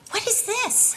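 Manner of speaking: wife recalls reading husband's improvement list, incredulous. Retold or imitated. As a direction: recoil in disbelief.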